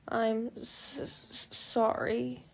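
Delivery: high, broken, slow stammer; stammering sob. A narrator's reading. Bella to Edward after crying, stammering apology. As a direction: choke out the apology through tears. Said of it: "I mumbled".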